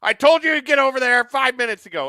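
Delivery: hammed-up teacher yelling at students; mock teacher scolding. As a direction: bark at the class like a strict teacher.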